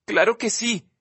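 a light hint of sobbing. Olivia insisting to Justin, lightly tearful. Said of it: "dice entre sollozos".

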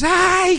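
high, loud "ay" of pain; mock child's cry. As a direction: yelp like a pinched child. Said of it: "gritaba y lloraba".

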